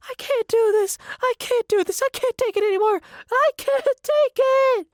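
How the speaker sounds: high, loud meltdown, building to the last line; very high, frantic screaming tantrum; hysterical yell. A narrator's reading. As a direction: yell it at the top of your voice in a hysterical fit. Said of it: "then yells".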